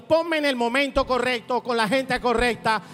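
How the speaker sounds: loud, driving declaration in rhythmic phrases; prédica enérgica. Real, not acted. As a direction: preach it at full force, rallying the congregation. Declaración a plena fuerza. (prédica a toda voz, con ímpetu)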